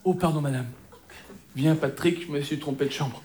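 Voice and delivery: low, soft, sheepish retreat; hushed and sheepish, dropping away; embarrassed apology. Acted. Embarrassed apology after wrong room.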